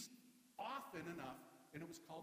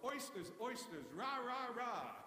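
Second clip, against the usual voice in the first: mock cheer, louder and higher on rah; imitating grandfather's cheer; 6 semitones higher, 6 dB louder, slower than usual. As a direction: chant it like a happy old fan. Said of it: "my grandfather used to go".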